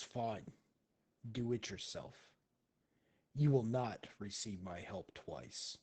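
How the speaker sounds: low, hushed in-character rebuff; quiet, stern warning; cold dismissal. Acted. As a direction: drop the voice and wash your hands of them. Shuten gives up on the player.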